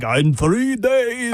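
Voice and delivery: menacing vampire, drawn out slowly. Retold or imitated. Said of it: "in a vampire voice".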